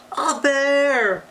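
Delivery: high, loud scream. A narrator's reading. Eddie screaming at the giant bear. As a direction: shriek it in fright. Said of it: "screamed Eddie".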